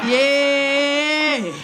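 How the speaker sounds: long, held-out yeah; mock an old man's drawn-out yeah. Older man agreeing for no reason, drawn out. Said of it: "Older men say yeah for no reason".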